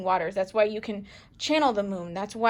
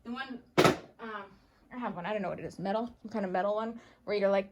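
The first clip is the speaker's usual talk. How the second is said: usual speaking voice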